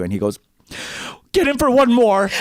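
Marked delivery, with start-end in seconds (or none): 1.3-2.3 eager stranger's call. Retold or imitated. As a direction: boom it like an overfriendly stranger. Stranger pulls her into hug.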